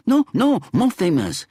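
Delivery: very high, loud protest; insisting. A narrator's reading. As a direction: shoot up high, excited. Man in lift insisting.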